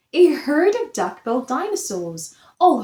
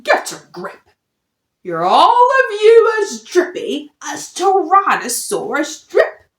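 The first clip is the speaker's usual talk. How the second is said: louder scolding father voice; gruff dad; 5 semitones higher, 6 dB louder than usual. Acted. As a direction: scold the family sternly. Dad scolds his frightened family.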